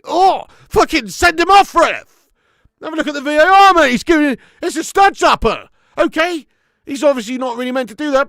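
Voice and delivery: yelling at the ref, high and loud; furious shout. Real, not acted. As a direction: scream for a red card, outraged. Furious rant demanding red card.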